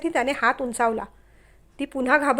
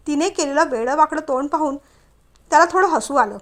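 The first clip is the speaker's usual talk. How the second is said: ordinary delivery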